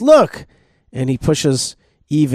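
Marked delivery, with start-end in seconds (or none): 0.0-0.4 sudden snake shout. Acted. Snake shoves Eve against tree.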